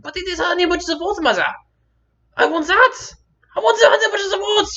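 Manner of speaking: put-on high, loud kid whining demand; mock childish outburst, very high; protesting child. Acts child arguing with mother.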